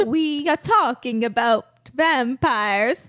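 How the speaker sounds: put-on slow, drawn-out Transylvanian vampire accent. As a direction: savor each word like Count Dracula. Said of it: "your vampire accent's amazing".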